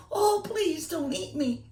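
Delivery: very high, mock-frightened little-girl plea; pleading Little Red. Little Red begging the hungry wolf, hammed-up high plea. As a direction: squeak it out like a scared little girl.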